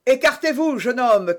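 high, loud warning cry; commanding shout. A narrator's reading. Jussac orders the young man aside, shouting. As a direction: call out an order to stand aside. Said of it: "cria Jussac".

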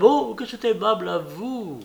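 high, affected hostess gush; simpering. A narrator's reading. Hostess greeting each lady the same way, simpering. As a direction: simper it with exaggerated politeness. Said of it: "les mêmes minauderies".

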